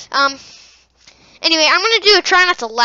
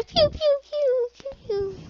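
high pew pew; 12 semitones higher, 7 dB quieter, slower than usual. Pretend. Sound effects.

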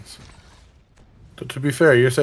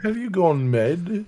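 put-on higher, slow French-accented commander; 6 semitones higher, slower than usual. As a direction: ask it slowly in a French accent. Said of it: "with a French accent".

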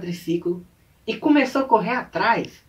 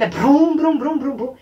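higher, louder motor brums; imitated engine revving; 6 semitones higher, 7 dB louder, slower than usual. Quad bike racing out of the court, imitated revving. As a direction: rev hard as the bike tears off. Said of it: "um trem acelerando".

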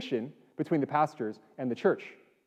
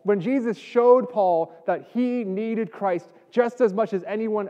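higher, forceful pulpit delivery; impassioned preaching; 6 semitones higher, 4 dB louder than usual. Real, not acted. Pastor telling the church all are redeemed alike, impassioned preaching. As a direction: build phrase on phrase with conviction.

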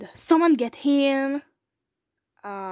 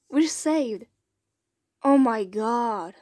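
normal talking voice

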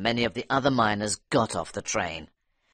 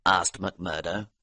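ordinary delivery, nothing marked

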